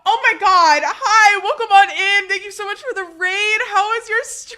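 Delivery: high-pitched flustered greeting through giggles; excited, laughing welcome. Real, not acted. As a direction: welcome surprise guests with squealing delight. Greeting a surprise raid.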